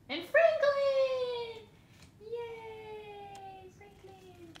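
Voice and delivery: high, slow, loud shout of joy; thrilled cry over a favourite card; excited shout. Real, not acted. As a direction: squeal his name out delighted. Thrilled at pulling Franklin card.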